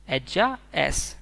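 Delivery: एज्जा एस stretched out slowly and clearly; slow model repeat. Shown as a demonstration. Teacher slowly modelling Spanish she is for students. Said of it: "बोलेंगे".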